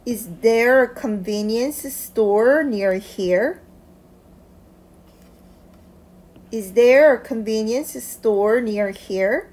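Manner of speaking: very slow, clear question with a rise at the end; slow model reading. Shown as a demonstration. Teacher reads the full question slowly for students to repeat. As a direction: read it slowly and carefully for learners to repeat. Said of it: "처음부터 다시 읽어볼게요".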